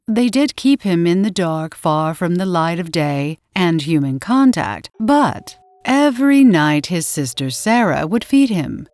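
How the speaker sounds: ordinary delivery, nothing marked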